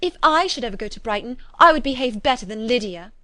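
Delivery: fretful. A narrator's reading. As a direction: protest like a put-out daughter. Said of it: "said Kitty fretfully".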